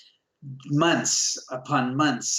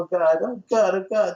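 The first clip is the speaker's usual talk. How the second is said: embarrassed; 5 semitones higher, 4 dB louder than usual. Retold or imitated. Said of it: "so embarrassed".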